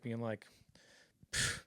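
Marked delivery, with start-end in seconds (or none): 1.3-1.6 imitated puff of reluctance; reluctant pfft. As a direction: blow out a mock-sulky pfft. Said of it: "not wanting to do it at all".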